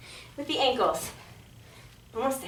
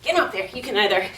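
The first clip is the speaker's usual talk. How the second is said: ordinary delivery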